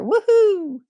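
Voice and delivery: high, loud playful woohoo; joyful whoop. Acted. Play saying we are safe to risk joy, whooping. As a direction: cheer it out like a kid at play.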